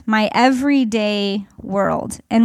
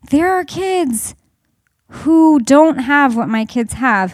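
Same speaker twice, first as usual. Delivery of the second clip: ordinary talk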